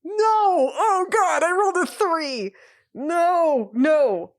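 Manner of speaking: high, loud wail of despair; anguished cries. Real, not acted. Player crying out after rolling a three. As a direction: cry out at the terrible roll.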